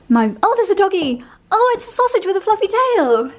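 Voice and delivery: high, loud squeal of joy over a dog; squealing with delight. Real, not acted. Vlogger spotting a sausage dog on her walk, squealing with delight. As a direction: gush over the puppy, voice shooting up.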